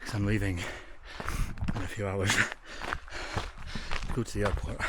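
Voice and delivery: breathless after a run; shallow breathing. Real, not acted. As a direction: still panting, words between breaths. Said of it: "you can tell by the way I'm breathing".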